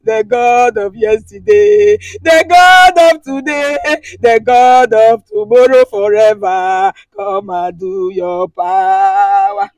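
pleading prayer chant, rising in force; fervent prayer. Real, not acted. Woman pleading with God in prayer. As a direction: pray it out, begging for power.

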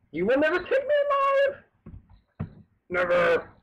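very high-pitched cry of defiance; mock defiant yell. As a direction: yell it like a hammed-up last stand. Mock defiance while crashing in game.

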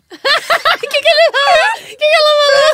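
high laughing shout; laugh-screaming the question; shrieking with laughter. Real, not acted. Shrieking laughter asking the quote.

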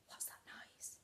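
soft unvoiced whisper; hush, something's there; scared mouse whispering; frightened whisper.

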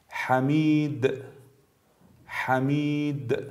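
slow حمید with long "ii" and bounced final د; brief qalqala. Shown as a demonstration. Tajweed teacher gives final dal example, final د echoed in حمید. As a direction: hold the vowel in حمید, then pop the د. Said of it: "مثال هایی هست حرف دال".

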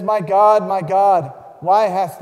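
loud cry that eases toward the end; anguished plea, loud at first and fading. A narrator's reading. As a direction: cry out to God, then let it fall. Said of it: "Jesus cried with a loud voice".

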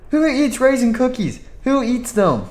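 high, disgusted question; disgusted outrage. Acted. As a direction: scoff at raisins. Disgusted boss.

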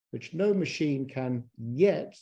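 slow, with YET drawn out and set off by a pause; stress on YET. Real, not acted. Lecturer qualifying a claim about machines, stress on "yet". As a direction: lean hard on the word "yet". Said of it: "I stress the word yet".

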